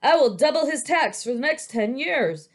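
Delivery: furious official threatening tax; angry magistrate. A narrator's reading. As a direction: rage at the absent painter. Magistrate raging at painter.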